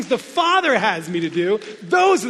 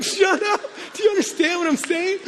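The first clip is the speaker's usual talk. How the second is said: exasperated self-scolding; 8 semitones higher than usual. Retold or imitated.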